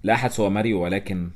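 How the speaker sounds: ordinary delivery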